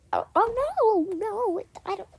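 mock panicked take-back; panicky backpedal. Friend backtracking her insult, mock panic. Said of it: "I'm like".

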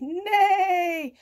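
loud, high, long mock whinny; horse neigh. A narrator's reading. Horse answering cat, loud high neigh. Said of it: "he neighed".

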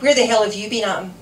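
loud, fast demand; contained yell of an angry wife; imagined yell. A narrator's reading. Wife imagining confronting late husband, yelled. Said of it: "If she ran and yelled".

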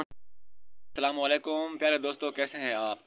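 slow, soft vlogger greeting; soft friendly channel opener; vlog host intro. Acted. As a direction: greet the viewers like a YouTube host.

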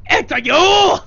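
high, loud accusing cry; a hammed-up gotcha shout; mock shout of recognition. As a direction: point and yell at the culprit. Character recognises the thief.

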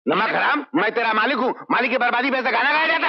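high, rising indignant rebuke; indignant scolding. Acted. Master rebukes servant over his song, indignant scolding. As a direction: scold him as his master, pitch climbing.

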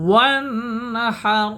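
ওয়ানহার with the h from mid-throat; throaty h. Shown as a demonstration. Teacher shows the throat h in ওয়ানহার. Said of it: "গলার মধ্যখান হতে".

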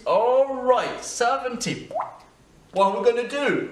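loud mock reaction. Learner faces seventy English accents, loud hammed-up exclamation. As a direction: call it out big and high, hammed-up.

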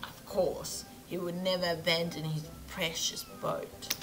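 inconsistent mock British-Australian accent; accent sliding from British to Australian. Shipbuilder recalling a grumbling customer, accent sliding from British to Australian. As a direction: wander from Pommy into Australian. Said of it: "I think I just went from, like, Pommy to Australia".

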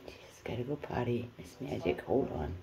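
hushed whisper aside. Real, not acted. Whispering aside to the dog while filming. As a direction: whisper it so the pet won't hear. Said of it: "unvoiced whisper aside".

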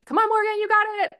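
high, loud sideline cheer; imitated encouraging yell. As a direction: re-enact a coach yelling to spur on a player. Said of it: "I yell, I'm like".

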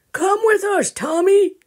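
high, loud friendly call. Acted. Grandpa calling Tommy from the car, high and loud. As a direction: call the boy off the bus.

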